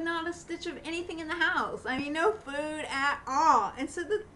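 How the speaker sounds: tearful. Real, not acted. Tearful about empty house.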